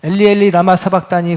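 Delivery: loud, anguished Eli Eli lama sabachthani; anguished loud cry. Acted. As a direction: shout the cry from the cross. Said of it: "절규와 같은 외침". (절규하듯 크게 외치는 소리)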